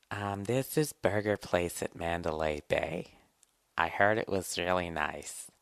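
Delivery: put-on soft, slow coy girlfriend voice; put-on demure girl naming her restaurant. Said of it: "girlfriend answers in scene".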